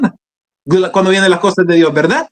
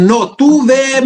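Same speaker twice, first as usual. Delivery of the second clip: slow, higher, insistent order; retold firm command; 7 semitones higher, much slower than usual. Holy Spirit answering the reluctant preacher, retold firm command. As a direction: overrule the objection slowly and firmly. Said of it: "me dijo el Espíritu Santo".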